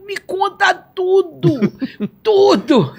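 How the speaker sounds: high, slow, laughing plea; ecstatic begging. Retold or imitated. Begging Jesus to tell her everything, high and laughing. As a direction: beg with joy breaking through. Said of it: "eu comecei a gritar".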